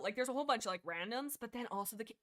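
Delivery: usual speaking voice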